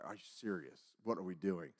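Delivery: very low, quiet retold grumble; muted annoyance. Fed-up man waiting in line, quiet retold annoyance. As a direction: complain under your voice. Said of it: "I'm so mad".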